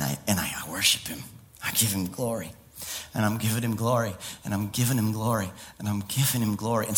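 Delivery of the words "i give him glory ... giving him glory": ordinary talk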